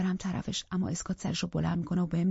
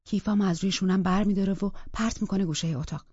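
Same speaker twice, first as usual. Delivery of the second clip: plain reading voice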